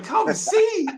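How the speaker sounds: very high incredulous question; baffled echo. Retold or imitated. Echoing the girls' odd phrase, high-pitched bafflement. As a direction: squeak it back in mock disbelief.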